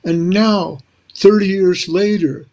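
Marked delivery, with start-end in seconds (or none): none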